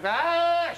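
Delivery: long high-pitched bawl; hammed-up child's howl of tears; mock crying wail. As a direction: imitate a small boy wailing. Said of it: "tout en pleurs".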